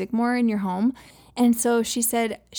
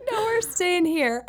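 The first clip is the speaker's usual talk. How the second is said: put-on much higher, slow, laughing child voice; mock child protest; 9 semitones higher, slower than usual. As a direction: whine it like a stubborn kid. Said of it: "You're like".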